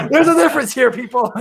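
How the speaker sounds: chuckling through it, pitch up; laughing aside. Real, not acted. Host laughing to listeners about wrestlers, amused. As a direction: laugh while you tell the people.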